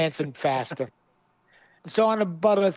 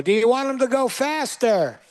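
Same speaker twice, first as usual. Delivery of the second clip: high, announcer-style question to the crowd; emcee on the mic; 7 semitones higher than usual. Retold or imitated. The mic emcee asking the crowd for more speed, announcer style. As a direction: call it out like a stage host. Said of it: "on a microphone will go".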